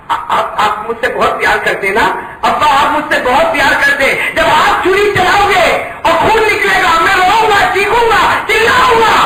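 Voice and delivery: put-on rising into a child's wail; building plea. Son pleads with father, building to a high wail.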